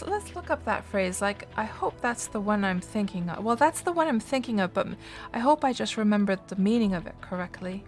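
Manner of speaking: ordinary talk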